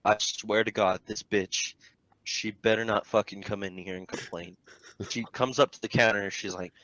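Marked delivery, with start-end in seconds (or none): 0.0-4.6 low, hushed thinking aloud; low warning to oneself; inner thought. Retold or imitated. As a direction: keep it under the voice, dark and soft. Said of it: "I'm thinking".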